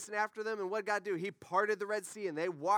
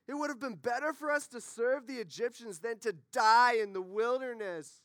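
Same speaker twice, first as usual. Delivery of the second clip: high-pitched, hammed-up grumbling; whining Israelites; 4 semitones higher than usual. Israelites blaming Moses for leaving Egypt, whiny mock protest. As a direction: complain like ungrateful freed slaves. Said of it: "They sounded like this".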